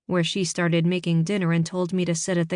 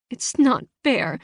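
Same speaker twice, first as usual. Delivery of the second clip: put-on high, slow, upset teenage girl; 6 semitones higher, 3 dB louder, slower than usual. A narrator's reading. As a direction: complain to an empty room. Girl alone, angry upset.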